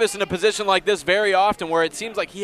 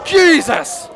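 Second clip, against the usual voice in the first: shocked shout; 9 semitones higher, 7 dB louder than usual. Real, not acted. Reacting to a big kick.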